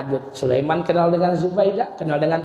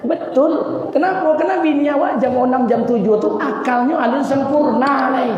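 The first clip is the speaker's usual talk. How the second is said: excited joking aside; 11 semitones higher than usual. Real, not acted. Preacher's aside joking to the audience.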